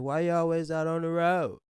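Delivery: high, loud Detroit father imitation; mock Detroit accent. Quoting Otis to his son, mock Detroit accent. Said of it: "that Detroit accent".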